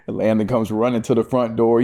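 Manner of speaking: ordinary talk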